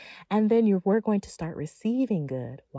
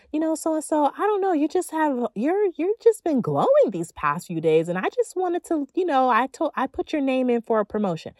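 boss praising; 7 semitones higher, 4 dB louder than usual. Retold or imitated. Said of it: "get a promotion".

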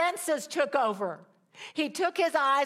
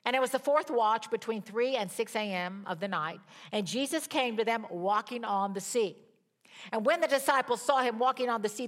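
ordinary talk, nothing marked

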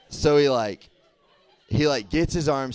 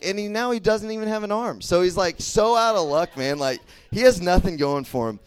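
ordinary talk, nothing marked

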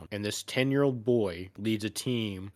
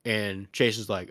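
normal talking voice

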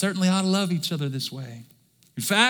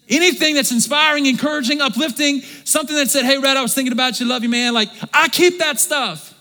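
preaching at full force; 8 semitones higher, 5 dB louder than usual. Acted. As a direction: build it up and hammer it home. Excited preaching about keeping cards.

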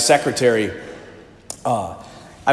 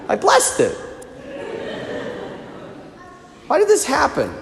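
high-pitched, mildly baffled complaint; mock plaintive protest; 7 semitones higher, 6 dB quieter than usual. Priest asking the Lord why his blessed car got wrecked, mock plaintive. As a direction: act out a pleading grievance, pitched well up. Acted complaint to God in chapel.

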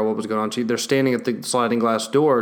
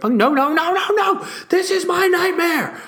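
much higher, wailing dog voice; panicking dog; slower than usual. Retold or imitated. The dog watching her walk away, a high wailing dog voice. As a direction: wail like an abandoned pup.

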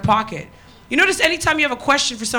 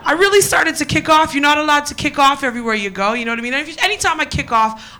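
ordinary talk, nothing marked

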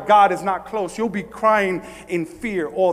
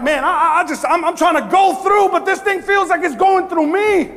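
raised, agitated voice of someone struggling; higher, strained-out complaint to the congregation; exasperated plea; 10 semitones higher, 5 dB louder than usual. Acted. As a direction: act out feeling overwhelmed, voice climbing. Voicing a struggler's frustrated cry.

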